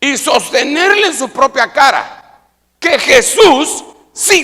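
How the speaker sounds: loud, high-pitched, forceful preacher delivery; insistent, shouted sermon climax; impassioned preaching. Real, not acted. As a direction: drive it home like a preacher at full force.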